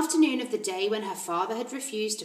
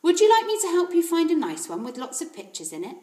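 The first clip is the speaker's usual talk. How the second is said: higher, friendly helpful voice; kindly librarian; 8 semitones higher, 8 dB louder than usual. Acted. Mrs Phelps offering Matilda picture books, kindly. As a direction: offer help like a kind librarian.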